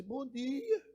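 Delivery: big boss with a tiny voice; soft, squeaky high greeting that rises; thin high voice. Acted. Big boss greeting the cowboy, thin high voice. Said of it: "ele fala fino".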